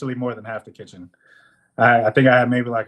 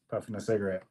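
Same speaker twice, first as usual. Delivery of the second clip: normal talking voice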